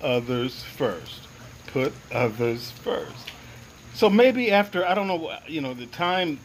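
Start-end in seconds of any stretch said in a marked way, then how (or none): 0.0-1.2 slow, low, measured message; neutral inner voice. Retold or imitated. Inner voice repeating its message to a yelling man, low and slow. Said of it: "came across as a neutral". 1.7-3.3 slow, low, soft intoning; neutral inner voice. Retold or imitated. Inner voice repeating its message, low, slow and soft. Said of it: "came across as a neutral".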